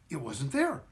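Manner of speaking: plain reading voice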